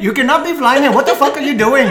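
mock an outraged man in an Indian accent. Retelling the landowner on a listener's request, in Indian accent. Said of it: "She wants the whole thing in the accent".

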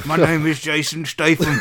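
laughing, half-shouted mock Cockney; bad Cockney accent. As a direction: have a go at Cockney and crack up. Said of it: "let alone Cockney accent".

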